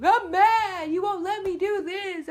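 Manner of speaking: high, loud mock tantrum; wailing youngest-child impression; crying and screaming kid. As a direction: imitate a screaming child throwing a fit. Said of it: "can be crying and screaming".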